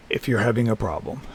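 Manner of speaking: ordinary delivery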